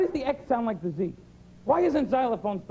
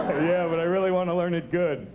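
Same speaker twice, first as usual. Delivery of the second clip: dumb student; 6 semitones lower than usual. Retold or imitated. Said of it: "aren't you from here?".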